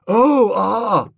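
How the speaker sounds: high startled exclamation. Acted. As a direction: blurt it out, caught off guard. Gussie startled, drunk.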